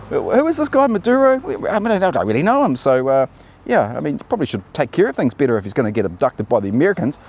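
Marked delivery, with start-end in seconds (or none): 0.1-2.8 much higher, airy and dismissive; mock clueless voice. Mocking Russia and China feigning ignorance, high and dismissive. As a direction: play dumb, as if you've never heard of him.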